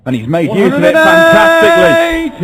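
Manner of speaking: excited shout. Real, not acted. Commentator erupting at big finish.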